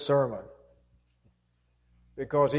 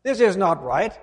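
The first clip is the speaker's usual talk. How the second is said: rising, louder indignant complaint; the older son protests the party, indignant; 5 semitones higher, 4 dB louder than usual. Retold or imitated. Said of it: "he said".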